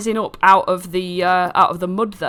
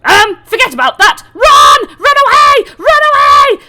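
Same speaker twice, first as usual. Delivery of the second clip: very high, loud screaming run away; panicked flight; 12 dB louder than usual. Acted. Rabbit-folk spotting mud imps, screaming to run. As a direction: shriek in terror and flee. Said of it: "and he goes".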